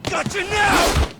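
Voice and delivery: loud, high taunting yell; gleeful loud taunt; taunting shout. Acted. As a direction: jeer at him loudly in the dark. Fight taunt in the dark.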